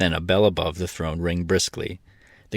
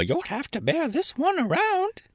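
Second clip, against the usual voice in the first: hen voice; 3 dB quieter than usual. A narrator's reading. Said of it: "declared Billina".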